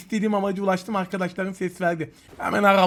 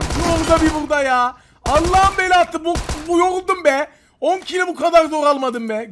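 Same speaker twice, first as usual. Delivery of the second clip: frustrated shout; 7 semitones higher, 5 dB louder than usual. Real, not acted. Streamer losing an enemy again, exasperated loud cursing. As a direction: bellow it, fed up.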